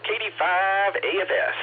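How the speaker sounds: high, drawn-out mimicked accent; [name] doing Grant. Radio host teasing Grant about his accent, high drawled impression. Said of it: "that's my impression of [name] doing Grant".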